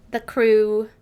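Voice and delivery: put-on booming overdone English accent; loud mock English accent. Streamer reading chapter titles to chat, loud mock English accent. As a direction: announce it like a pompous Brit. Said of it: "Made it more terrible".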